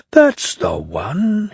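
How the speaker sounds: slow, satisfied thinking aloud; thought aloud. Acted. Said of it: "he thought".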